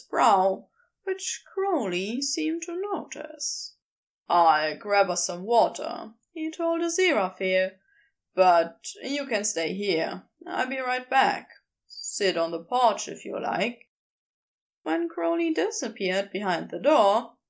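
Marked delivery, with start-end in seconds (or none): 4.3-6.1 lower, firmer man's voice; a lower Crowley, teasing and sure; Crowley's voice. Acted. 8.3-13.9 lower, firmer man's voice; a lower Crowley, teasing and sure; Crowley's voice. Acted.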